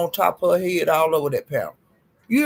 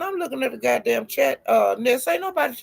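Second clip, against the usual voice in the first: higher, rising, faster retort; host snapping back at Ness, higher and faster; calling her out; 7 semitones higher, faster than usual. Retold or imitated. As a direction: shut down the lie with rising heat. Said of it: "She said".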